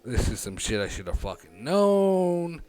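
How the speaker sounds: angry rant. Retold or imitated. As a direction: rant at her, fed up. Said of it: "I'm just like".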